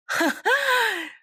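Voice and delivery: high, drawn-out scornful laugh; mocking laugh. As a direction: laugh in their face, high-pitched.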